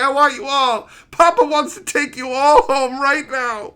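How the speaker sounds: high, loud, greedy cooing at the decks; greedy excited gushing. Retold or imitated. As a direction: gush over the decks like a hoarding collector. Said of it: "staring at all those Commander decks today going".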